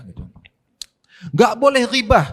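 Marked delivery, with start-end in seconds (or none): 1.4-2.2 mock sermon proclamation. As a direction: boom it out like a preacher. Ustaz berkhutbah menirukan ceramah. (meniru khotbah keras dan lambat)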